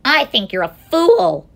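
high, loud jeer; mocking sour kangaroo. A narrator's reading. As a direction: scoff at him loudly. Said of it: "laughed the sour kangaroo".

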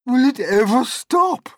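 high, loud excited shout. A narrator's reading. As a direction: shout it out in amazement. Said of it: "Aunt Sponge shouted".

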